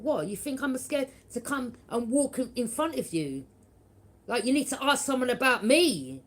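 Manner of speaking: replaying the fury of being looked down on; angry retort. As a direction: throw the challenge back at them. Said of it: "I was fuming".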